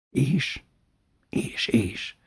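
soft, near-whispered question; quiet, cautious nudge to go on; hushed prompting. A narrator's reading. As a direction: ask it under your breath. Hushed anxious exchange at door.

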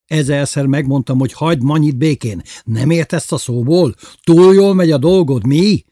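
loud, high-pitched scolding building up; angry yelling. A narrator's reading. Bubu tells Lili to leave Manyi alone, yelling angrily. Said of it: "ordította el magát Bubu bácsi".

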